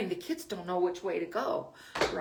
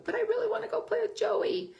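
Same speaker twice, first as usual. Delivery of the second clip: put-on whiny child; 13 semitones higher than usual. Child voiced pleading in example.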